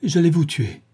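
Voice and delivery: horrified cry. A narrator's reading. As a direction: blurt it out in horror. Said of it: "cria-t-il".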